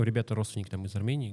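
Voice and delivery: ordinary talk, nothing marked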